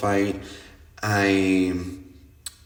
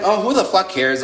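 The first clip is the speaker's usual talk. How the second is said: louder, higher mock-exasperated brush-off; dismissive outburst; 8 semitones higher, 5 dB louder than usual. She snaps back at angry friends, loud and dismissive. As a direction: toss it off like it's nothing, loud.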